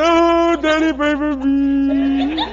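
put-on slow, high, pleading child's voice through a laugh; imitating devastated toddler. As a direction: stretch out a little boy's tearful plea. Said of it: "He was looking at me devastated".

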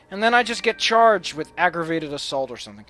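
usual speaking voice

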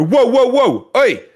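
loud, high alarmed "whoa, whoa, hey"; startled shout. Retold or imitated. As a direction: call out in alarm at the intruders. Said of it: "I go".

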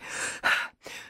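sharp breathless gasp; gasp, still shaken; frightened gasp. Acted. Frightened neighbour catches breath.

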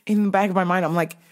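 ordinary talk

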